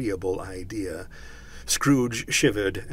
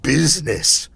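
loud, raised spectral outburst; indignant cry; 5 semitones higher, 10 dB louder than usual. A narrator's reading. Ghost rejecting Scrooge's excuse, crying out. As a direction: hurl the word back. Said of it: "cried the ghost".

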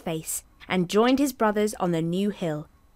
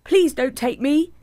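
higher, louder begging goat; pleading goat; 7 semitones higher, 5 dB louder than usual. Acted. Second goat begging the troll to wait, higher and louder. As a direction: plead to be spared, a bit high.

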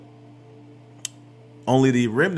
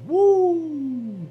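excited whoop. Real, not acted. Preacher exults after reading verse.

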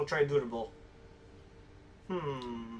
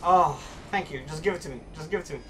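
usual speaking voice

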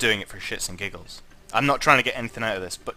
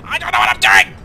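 panicked cry; 4 semitones lower, 11 dB louder than usual. Retold or imitated. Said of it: "you're just like".

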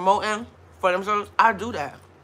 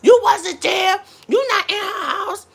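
retold telling-off; 10 semitones higher, slower than usual. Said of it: "I did come in like".

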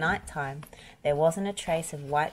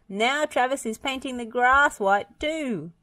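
high, gleeful character voice; chuckling mischief-maker; 8 semitones higher, 3 dB louder than usual. A narrator's reading. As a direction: gloat over the prank, pitched well up. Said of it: "he chuckled".

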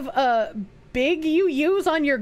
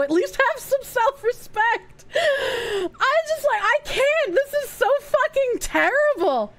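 high, strained, appalled outburst; exasperated dismay; 8 semitones higher than usual. Real, not acted. Streamer despairing at a predator's messages, exasperated dismay. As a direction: plead in high-pitched disbelief.

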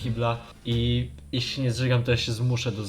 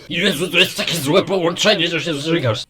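disgusted reaction; 3 semitones higher, 5 dB louder than usual. Retold or imitated. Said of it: "To nie jest taka reakcja typu".

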